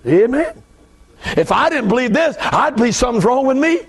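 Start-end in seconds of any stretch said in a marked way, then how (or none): none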